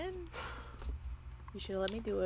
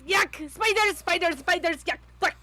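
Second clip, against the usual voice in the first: louder, higher spider-panic yelps; in-character screaming; 5 semitones higher, 6 dB louder than usual. Acted. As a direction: act out yelping in disgust at spiders. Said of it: "Why are you screaming?".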